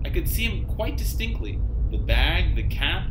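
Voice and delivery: ordinary talk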